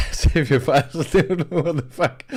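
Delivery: cracking up at a friend's panic; laughing. Real, not acted. Laughing hard at a friend's constant file saving.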